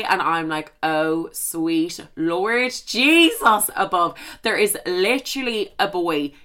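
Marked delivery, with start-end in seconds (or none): 0.8-4.1 slow, drawn-out dread; mock horror. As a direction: stretch each word in hammed-up horror. Retold horror at mum calling.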